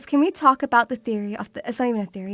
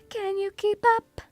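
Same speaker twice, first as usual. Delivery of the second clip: high, quiet teasing voice; pitched-up tease; 7 semitones higher, 4 dB quieter than usual. Pretend. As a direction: taunt it in a squeaky voice.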